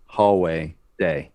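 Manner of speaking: normal talking voice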